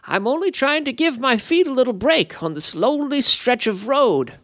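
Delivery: high, sly little sprite voice; a cheeky fey playing innocent; impish sprite. A narrator's reading. The sprite excuses himself to the guards, high and sly.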